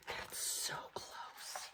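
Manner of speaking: slow, breathless whisper; suspense whisper. Acted. Reader whispering at the near miss. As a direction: hold the tension in a whisper.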